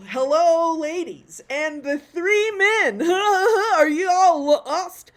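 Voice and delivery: high, loud, drawn-out mock greeting with laughter; tactless host. Host singling out the three men, loud mock-gushing. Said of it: "you don't want to say".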